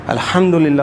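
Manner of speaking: الحمدللہ with a strong breathy ح from the throat; correct throaty ha. Shown as a demonstration. Teacher shows what must be practised, throaty ha. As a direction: stress the airy ح in الحمدللہ. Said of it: "ہم نے اسے کرنا ہے".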